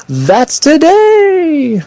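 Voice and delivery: high, drawn-out exclamation; slow, high excited announcement. Real, not acted. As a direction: stretch it out in surprise. Excited host announcing release.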